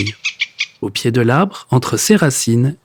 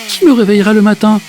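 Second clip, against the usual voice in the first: higher and fast worried question; a hurried, pitched-up lament; anxious plea; 7 semitones higher, 3 dB louder, faster than usual. Acted. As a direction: rush the question out in distress. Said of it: "bredouille Monsieur Marcelin".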